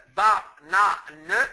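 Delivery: banane stretched slowly into ba-na-ne; banane, each syllable spaced out. Shown as a demonstration. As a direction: slowly sound out banane with three beats, e muet voiced. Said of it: "il y a trois syllabes".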